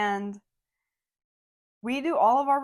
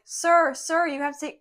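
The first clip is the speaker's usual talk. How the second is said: raised, higher calls of sir; calling after him; 5 semitones higher, 6 dB louder than usual. Retold or imitated. Cashier calling after a customer walking off, loud. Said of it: "I'm yelling at him".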